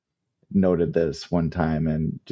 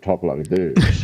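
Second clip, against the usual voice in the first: slow put-on Southern old-man drawl; hammed-up accent of an old man from the South; 4 dB louder, slower than usual. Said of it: "I don't know where that accent came from".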